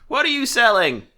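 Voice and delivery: high, loud woman customer; middle-aged woman voice. Acted. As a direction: pitch it up as a pushy lady shopper. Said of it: "Middle-aged woman walks up".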